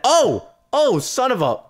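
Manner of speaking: high, loud exasperated cry; loud gamer frustration; startled outburst. Real, not acted. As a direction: yell it, cutting off the curse. Cut-off curse during gameplay.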